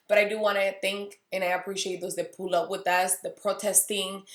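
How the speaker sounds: ordinary delivery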